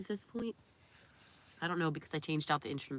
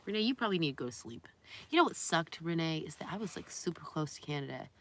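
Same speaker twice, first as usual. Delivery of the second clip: usual speaking voice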